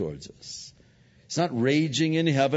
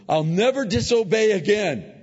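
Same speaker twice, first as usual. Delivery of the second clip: put-on much higher, louder child voice; little child's promise; 11 semitones higher, 7 dB louder than usual. A two-year-old promising a parent, high and eager. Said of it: "child said to you".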